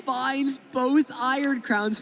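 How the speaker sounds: high, slow, drawn-out hype call; excited call. Real, not acted. Caster thrilled as the player draws both cards, excited. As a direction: stretch it out like the big moment of the match. Caster reacts to a lucky draw.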